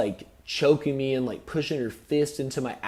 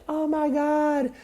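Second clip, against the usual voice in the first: very high, slow, mocking squeal; slow high put-on voice mocking the other woman; mock innocent high voice; mocking girly voice; slower than usual. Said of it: "And she's like".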